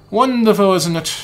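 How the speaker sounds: put-on light British accent, half-hearted; a slightly put-on British gentleman. Umbrella Man chatting to the boy in a mild British accent. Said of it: "let's give him a British voice".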